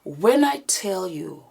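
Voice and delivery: breathy whisper recounting a scary encounter, slow at times; hushed whispered storytelling. Acted. Telling viewers what happened to her and Karine, in a tense whisper.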